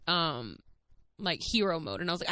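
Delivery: normal talking voice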